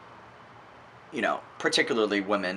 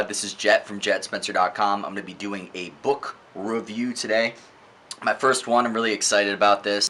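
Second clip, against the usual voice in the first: ordinary talk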